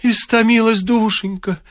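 high, sing-song widow lament; lilting lament pitched high. A narrator's reading. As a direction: croon the words like a grieving widow. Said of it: "запела вдова".

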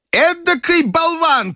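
put-on high, slow outraged old lady; shouting grandmother. A narrator's reading. Grandmother berating the Frenchman, high outraged cry. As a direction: blast the Frenchman as a fool. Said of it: "крикнула бабушка".

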